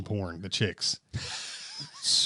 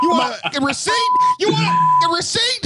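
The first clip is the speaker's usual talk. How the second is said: high, loud, mocking shout of a surly cashier; a hammed-up yelled question, louder and higher each time; 4 dB louder than usual. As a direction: bark it up high like a mean cashier. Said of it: "bulldog face".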